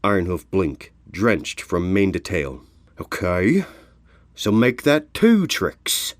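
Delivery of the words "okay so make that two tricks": grudging. Acted. Drenched Ironhoof concedes.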